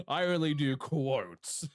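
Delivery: slow, loud, high teasing boast; mock boast. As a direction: brag about it playfully.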